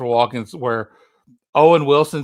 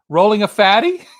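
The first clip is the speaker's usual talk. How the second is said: put-on higher, louder questioning kid voice; imitated little boy; 7 semitones higher, 6 dB louder than usual. Little boy answering at the kids' table, high imitated voice. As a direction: pipe up like a kid guessing. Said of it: "the little boy goes".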